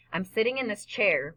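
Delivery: plain reading voice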